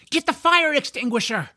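high-pitched urgent command; urgent order. A narrator's reading. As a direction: call for help in a hurry. Urgent order as dragon attacks.

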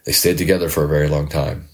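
ordinary delivery

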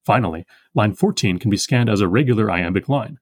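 ordinary delivery

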